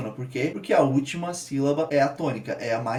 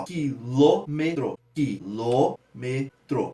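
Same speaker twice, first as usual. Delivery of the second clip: "Quilômetro" very slow, syllable by syllable, LÔ standing out; stressed syllable demo; 3 semitones lower, much slower than usual. Shown as a demonstration. Teacher showing students the tonic syllable, slowly split with stressed LÔ. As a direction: stretch "Quilômetro" into ki, LÔ, me, tro. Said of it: "no lô ele sobe".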